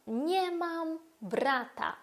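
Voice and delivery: slow Polish Nie mam brata; Polish model. Shown as a demonstration. Teacher modelling a Polish sentence slowly. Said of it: "Можемо сказати".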